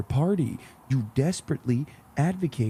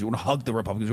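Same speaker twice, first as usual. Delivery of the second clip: usual speaking voice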